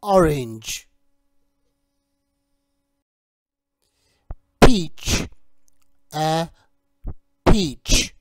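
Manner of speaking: slow, clear teacher voice modelling each word; teacher drilling a young English learner on fruit names; vocabulary drill. Shown as a demonstration. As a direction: model each fruit name for a child to repeat.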